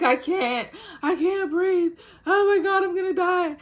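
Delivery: very high, loud terrified cry; panicked wail. Retold or imitated. To Nurse Nicole, a high panicked wail. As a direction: wail that you're going to die. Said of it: "I'm like".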